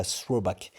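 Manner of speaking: English word in a French accent; bad English accent. Real, not acted. Trader attempting an English term for viewers, bad English accent. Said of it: "mon accent anglais est immonde".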